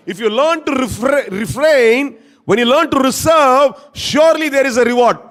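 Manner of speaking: slow, loud, high pulpit English; emphatic preaching. Acted. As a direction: stretch each phrase and proclaim it.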